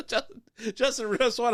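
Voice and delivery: usual speaking voice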